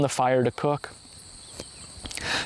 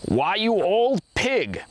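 slow, higher, hammed-up angry cry; angry shout; 7 semitones higher, slower than usual. First man accuses the second of stealing, mock angry shout. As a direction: bellow the insult at the thief. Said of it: "shouted the first man".

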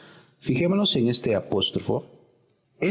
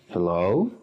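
"hello" with the second syllable stressed and rising; 2 semitones lower than usual. Shown as a demonstration. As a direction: lean on the end of "hello". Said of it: "el acento siempre en la segunda sílaba".

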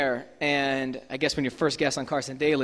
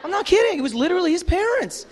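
much higher and louder, laughing; laughing disbelief; 14 semitones higher, 4 dB louder than usual. Real, not acted. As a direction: crack up in disbelief. Disbelief at meeting his parents.

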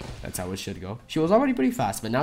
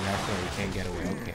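ordinary talk, nothing marked

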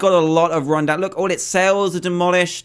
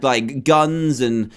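usual speaking voice

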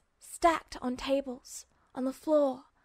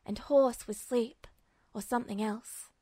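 plain reading voice

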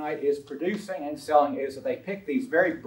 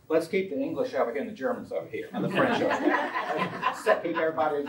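amused laugh. Real, not acted. Jokes about sorting china by nation.

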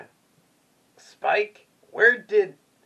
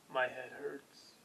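pained groan; 10 semitones lower, 18 dB quieter, much slower than usual. Pretend. Character giving up, groaning.

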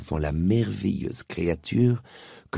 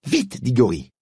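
raised urgent call; shouted; 6 semitones higher, 4 dB louder than usual. Acted. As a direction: call him to hurry. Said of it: "cria Polly". (cri pressant)

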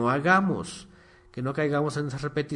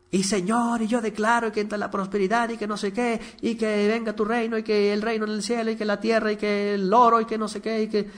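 high, theatrical preacher voice reeling off demands; mocking preacher prayer; 7 semitones higher than usual. The pastor mocks televangelists repeating prayers, in a high mock preacher voice. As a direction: mimic a preacher droning the same declarations. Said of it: "a poco no oran gritando lo mismo?".

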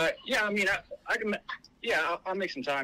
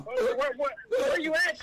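high, urgent raised voice; real alarm; 11 semitones higher than usual. Real, not acted. Officer answering screaming caller, real urgent alarm. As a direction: cut in urgently over the screaming.

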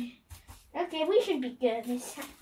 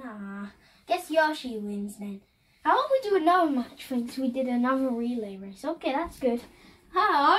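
ordinary delivery

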